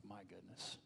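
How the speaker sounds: very soft, stunned murmur; stunned hush. Retold or imitated. Humbled by life, a very soft stunned murmur. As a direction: breathe it out, humbled. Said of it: "I was like".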